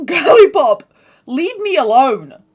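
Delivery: loud, high, slow diva brush-off; sassy brush-off. Acted. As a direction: shoo her off with sass. Sassy dismissal.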